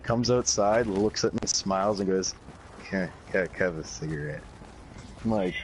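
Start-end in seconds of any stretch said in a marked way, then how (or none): 2.9-4.4 low, soft, halting stammer; mock groggy mumble, trailing lower; imitated stoned friend. Stoned Joe asks Nate for a cigarette, imitated groggy and halting.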